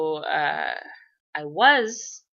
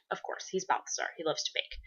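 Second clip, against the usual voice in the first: whisper; 10 dB quieter than usual. Real, not acted. Quiet aside about character.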